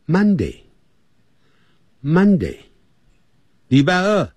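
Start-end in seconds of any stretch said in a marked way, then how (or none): 0.1-2.6 Monday said slowly twice; slow teacher model of Monday; slow model reading. Shown as a demonstration. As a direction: stretch out Monday for students to echo. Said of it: "我们现在就跟着讲".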